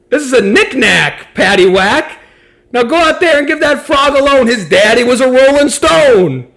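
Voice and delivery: high, loud manager's proclamation; bellowed punchline. Acted. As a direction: belt out the punchline as the exasperated manager.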